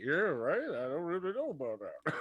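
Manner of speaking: towering Athos voice. Acted. Seven-foot Athos greeting the small satyr, formal. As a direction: greet him politely from above.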